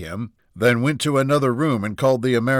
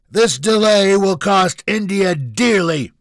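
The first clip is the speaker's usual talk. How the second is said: slow, high, very loud threat; angry bellow; 11 semitones higher, 9 dB louder, slower than usual. Acted. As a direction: bellow it out, drawing the words long. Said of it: "Junior bellowed".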